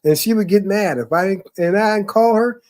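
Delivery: ordinary talk, nothing marked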